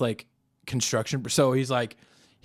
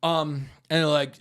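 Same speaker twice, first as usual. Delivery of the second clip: ordinary talk, nothing marked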